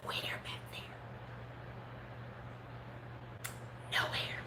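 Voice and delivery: whispered in disbelief; stunned whisper. Real, not acted. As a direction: breathe it out, hushed and stunned. Shock at shaved head.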